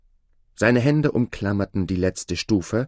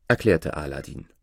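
plain reading voice

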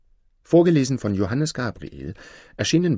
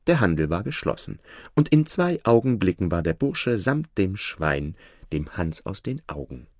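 ordinary delivery

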